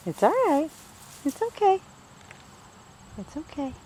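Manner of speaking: high, gentle reassurance to a frightened pet; soothing pet voice. Real, not acted. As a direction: coo it softly to calm her.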